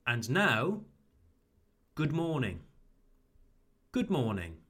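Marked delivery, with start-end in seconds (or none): 1.9-2.6 "good morning" with short vowel in good, falling tune. Shown as a demonstration. Teacher models the fix for learners, short oo in "good". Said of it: "make it shorter". 3.9-4.6 "good morning" with short vowel in good, falling tune. Shown as a demonstration. Teacher models the fix for learners, short oo in "good". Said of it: "make it shorter".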